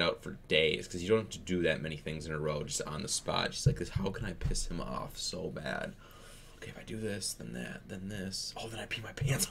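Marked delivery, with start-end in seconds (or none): 3.8-9.5 much softer, plotting to oneself; hushed, step-by-step mock scheming; scheming child. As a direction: imitate a kid quietly hatching a plan. Imagined child plotting mischief.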